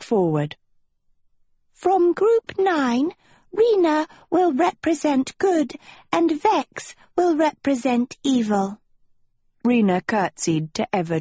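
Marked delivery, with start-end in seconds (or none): 1.7-8.8 a tiny forest sprite announcing proudly; tiny nymph voice. Acted. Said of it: "A tiny lily nymph in a dress of emerald vines".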